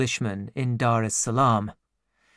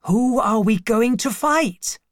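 put-on higher eager boy's voice; eager servant boy; 8 semitones higher, 3 dB louder than usual. A narrator's reading. Servant boy asks the narrator who they will fight, eager. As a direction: ask it keen for a fight.

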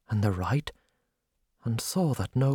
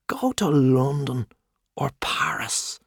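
whisper. Acted. Confiding aside in bar talk.

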